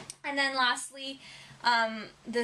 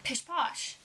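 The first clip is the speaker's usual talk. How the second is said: breezy pish posh; 3 semitones higher than usual. Retold or imitated. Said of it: "it's kind of like".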